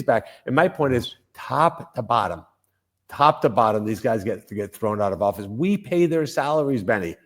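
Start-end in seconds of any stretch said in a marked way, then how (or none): none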